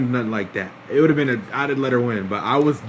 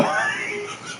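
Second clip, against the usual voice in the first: high wheezing giggles; laughing fit; 6 dB quieter than usual. Real, not acted. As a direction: keep laughing until breathless. Cracks up at own slip.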